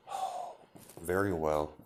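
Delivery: understated weary acceptance; resigned agreement. Acted. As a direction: give in reluctantly, quietly. Reluctant agreement after a sigh.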